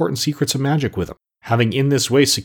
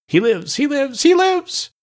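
rising higher with each rapturous cry; slower than usual. Acted. Mombi dances a jig repeating it, rising rapturously. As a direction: chant it in rapture, climbing up. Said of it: "she repeated rapturously".

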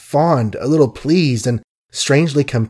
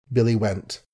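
plain reading voice

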